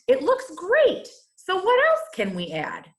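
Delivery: suddenly won over; grumpy Square finally impressed; delighted surprise. Acted.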